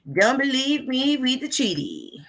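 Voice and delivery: mock-sweet high-pitched challenge; playful singsong.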